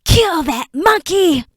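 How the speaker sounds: high, loud, slow shriek; frantic shriek. Acted. Creature shrieking as Enoch escapes. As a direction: scream the order in panic.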